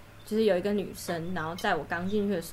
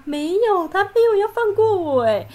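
much higher and louder, exclaiming; indignant outburst; 11 semitones higher, 9 dB louder than usual. Real, not acted. Telling friends about the senior, loud indignation. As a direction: burst out, unable to believe it. Building indignant story climax.

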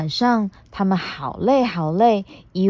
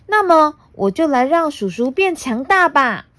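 put-on high, bright little girl; 7 semitones higher than usual. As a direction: chatter like a cheeky young girl. Puna speaks.

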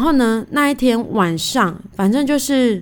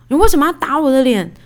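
mock-angry demand, a bit louder; indignant retort; 5 semitones higher, 4 dB louder than usual. Wife confronting husband who slapped her, indignant. Retold fight after husband's slap.

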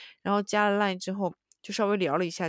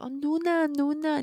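slow, higher sing-song calling; clingy Korean coworker; 8 semitones higher, 4 dB louder, much slower than usual. Retold or imitated. Korean coworker cozying up, slow sing-song calling. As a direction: drag out the Nuna sweetly.

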